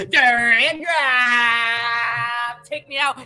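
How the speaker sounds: usual speaking voice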